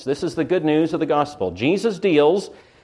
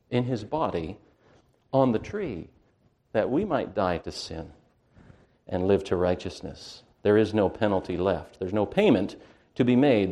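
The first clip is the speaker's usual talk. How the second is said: hushed preaching; 5 semitones lower, 6 dB quieter than usual. Acted. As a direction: drop down and preach it gently, with weight. Quiet preaching.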